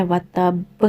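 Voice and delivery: وَتَبْ with the final ب bounced hard and echoing; heavy qalqalah. Shown as a demonstration. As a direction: give وَتَبْ a strong bouncing ب at the end. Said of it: "heavy والا قلقلہ کرنا ہے".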